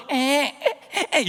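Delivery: short, high, bleating mock sobs; imitated normal baby cry. Pastor showing how babies should cry, short high mock wails. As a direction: mimic an ordinary infant crying in small bursts. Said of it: "요렇게 울어야 되는데".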